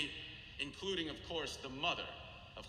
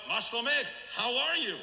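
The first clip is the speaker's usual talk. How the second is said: sudden louder, higher blurt; the rabbi blurts a greeting at the grieving mother, louder and higher; 8 semitones higher, 7 dB louder than usual. Retold or imitated. Let the question burst out unplanned, high and loud. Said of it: "he blurts out".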